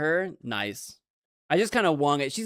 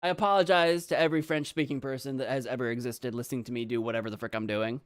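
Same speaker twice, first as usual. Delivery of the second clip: normal talking voice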